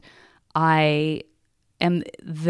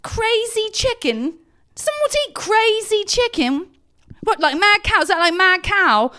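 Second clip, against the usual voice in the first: over-enunciated British bafflement, much higher and louder; imitating Chris's British accent; 4 dB louder than usual. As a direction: enunciate every word in puzzled wonder. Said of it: "he enunciates so much".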